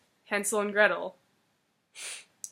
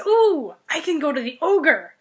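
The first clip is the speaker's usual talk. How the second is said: bright sudden eureka, louder; excited idea; 4 semitones higher, 7 dB louder than usual. Acted. Fox realizing she can trick the ogre, excited. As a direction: hit on the plan with a thrilled gasp.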